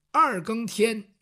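ordinary delivery, nothing marked

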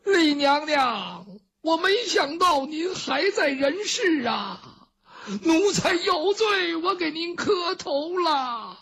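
high, drawn-out weeping lament; slow kowtowing servant's cry; tearful wailing. Acted. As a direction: plead for forgiveness in a long, high wail. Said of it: "陈琳在后边这一哭".

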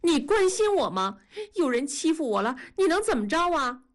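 high, sharp yell, rushing at the end; tearful yelling. A narrator's reading. Daughter yelling at her father from the doorway, high tearful shout. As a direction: shout it at him through tears. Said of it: "瞪大了眼睛冲着父亲嚷".

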